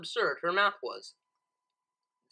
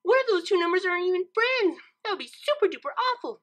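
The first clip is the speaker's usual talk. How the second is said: much higher, louder fretting; mock worry; 10 semitones higher, 4 dB louder than usual. A narrator's reading. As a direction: fret dramatically. Pinkie worried aloud.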